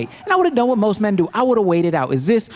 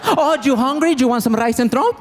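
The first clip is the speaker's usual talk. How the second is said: sweet scheming mom; 6 semitones higher than usual. Retold or imitated. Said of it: "that's how she thinks".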